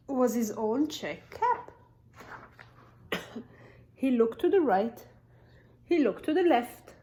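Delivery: ordinary delivery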